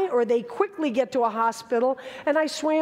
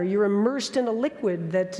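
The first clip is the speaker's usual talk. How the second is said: ordinary talk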